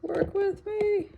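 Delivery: high, slow, coaxing plea to the gear; pleading. Real, not acted. Begging her tripod to cooperate, high and slow. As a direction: coax the stubborn tripod, drawn out.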